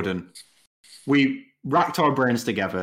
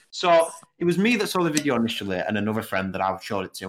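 ordinary talk, nothing marked

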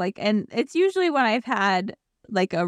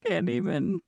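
mock breakdown; 7 semitones higher than usual. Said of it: "It's like".

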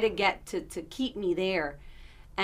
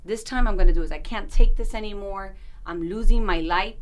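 desperate resolve; 10 dB louder than usual. Retold or imitated. Retelling her own resolve.

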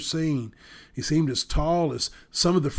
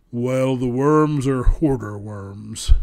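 dragon king voice; 8 dB louder, slower than usual. A narrator's reading. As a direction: speak as a grave old dragon king. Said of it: "explained the king".

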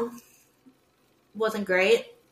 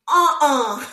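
loud, high dismissive uh-uh; emphatic no; 4 semitones higher, 10 dB louder than usual. Retold or imitated. Refusing boyfriend's golf idea, loud and dismissive. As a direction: shut the idea down hard.

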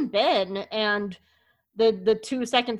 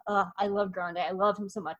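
ordinary talk

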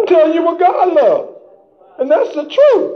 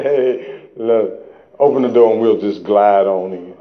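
low, sly devil voice. Pretend. Preacher voicing the devil asking for a free ride, low sly devil. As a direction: drop way down and play the devil slipping in.

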